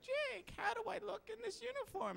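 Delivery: very high, soft grandma voice; tiny falsetto old-lady caricature; mock grandma. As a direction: squeak it out as a grandma showing off. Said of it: "can you imagine Grandma coming over".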